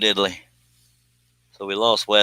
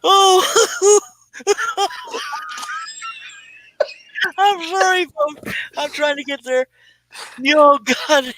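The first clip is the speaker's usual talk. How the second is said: much higher, squeaky, words breaking into wheezing laughs and sobs; helpless laughing fit, tears streaming, trying to talk through it; crying with laughter. Real, not acted. Host apologizing to viewers while crying with laughter. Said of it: "no way I can stay on the lines laughing like this".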